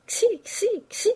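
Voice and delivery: high sibilant calls, as to egg on an animal; teasing hissed urging. A narrator's reading. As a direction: hiss sharply to set him moving. Painter teasingly urges Poiret on.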